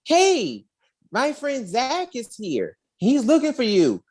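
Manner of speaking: lightly voiced eager little girl. Little girl telling [name] in a high eager voice. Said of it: "And she's like".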